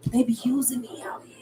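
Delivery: whispered confiding aside. Real, not acted. Telling viewers that spirit uses her, in a whisper.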